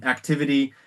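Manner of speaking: ordinary talk, nothing marked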